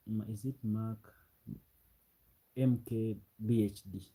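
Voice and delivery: ordinary delivery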